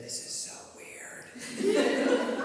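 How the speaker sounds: imitating her slow, laughing whisper; a breathy, giggly mock whisper, drawn out; retold whisper. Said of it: "she whispered next to me".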